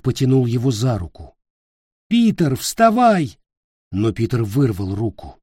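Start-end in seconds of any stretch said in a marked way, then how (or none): 2.1-3.4 high, loud, slow command; urgent shout. Acted. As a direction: order him up now. Nick pulls Peter.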